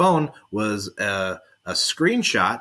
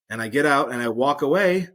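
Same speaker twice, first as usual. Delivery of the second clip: ordinary talk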